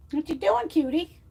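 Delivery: cooing at her cat; pet talk. Real, not acted. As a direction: coo at the cat lovingly.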